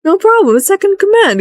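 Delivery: brightly snitch on second command; chirpy guard. Retold or imitated. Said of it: "It's like".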